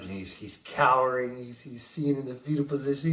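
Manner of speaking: normal talking voice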